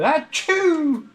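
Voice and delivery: loud, high sneeze sound; fake sneeze. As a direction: belt out a big fake sneeze.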